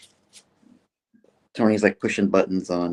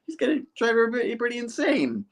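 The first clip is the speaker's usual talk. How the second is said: much higher, rushing; flustered denial; faster than usual. Retold or imitated. Peter frantically denies inviting Jonah, high and rushed. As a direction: blurt it out fast. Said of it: "He's like".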